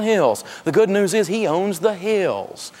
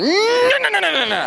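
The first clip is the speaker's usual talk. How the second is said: much higher, a chorus of little angels protesting; angel choir crying no; 13 semitones higher than usual. Retold or imitated. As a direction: squeal the angels' protest up high. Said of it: "eighty thousand angels in that engine going".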